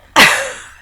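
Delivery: high, loud burst of laughter; real rising laugh. Real, not acted. Laughs at the article.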